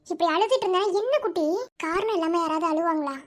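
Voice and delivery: usual speaking voice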